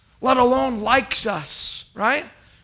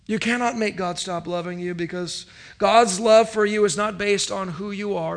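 ordinary talk, nothing marked

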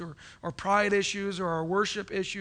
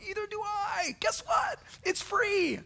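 excited; 11 semitones higher than usual. Acted. Good news burst after soft lines.

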